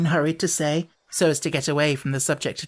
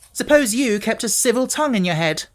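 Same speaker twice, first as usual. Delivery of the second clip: snapped; 7 semitones higher, 3 dB louder than usual. A narrator's reading. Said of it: "Robert snapped".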